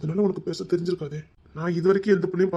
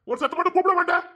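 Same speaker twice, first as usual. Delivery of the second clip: angry shriek; 9 semitones higher than usual. Acted. Scolding shriek.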